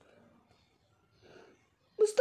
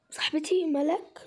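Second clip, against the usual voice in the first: whisper; slower than usual. Acted. Catching her friend with boyfriend.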